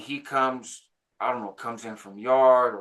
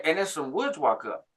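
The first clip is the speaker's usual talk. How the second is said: usual speaking voice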